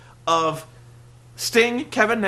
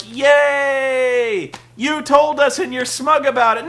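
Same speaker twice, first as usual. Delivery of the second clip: sarcastic crowd cheer; 5 semitones higher, 4 dB louder, slower than usual. Retold or imitated. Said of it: "Is the crowd gonna be like".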